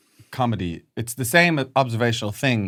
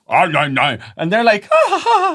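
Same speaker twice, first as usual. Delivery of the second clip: much higher, loud mock reaction ending in a fake laugh; mocking them; 9 semitones higher, 8 dB louder than usual. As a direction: ham up their silly laugh. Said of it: "They're like this".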